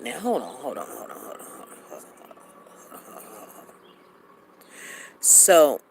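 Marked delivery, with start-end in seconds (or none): 0.0-3.6 fading to whisper. Acted. Pleading hold on, fading out.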